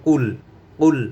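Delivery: "কুল" punched, stopping on the l; correct closed syllable. Shown as a demonstration. Teacher warns learners against kulu, "কুল" closed on l. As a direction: say "কুল" as one crisp syllable without final u. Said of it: "কুল না হয়ে যায়".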